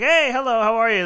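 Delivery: bright mock-friendly greeting. A coworker's friendly greeting, mimicked warmly. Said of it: "very friendly to me".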